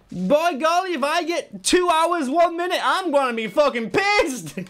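very high, put-on comic voice; mock-excited squawk, drawn out; hammed-up outburst. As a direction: exclaim it in a silly high-pitched character voice. Hammed-up outburst during a game run.

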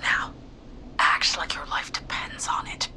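urgent hushed whisper. Acted. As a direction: whisper so the killer can't hear. Hiding from killer, whispering.

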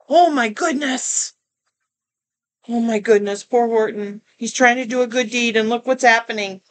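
ordinary delivery

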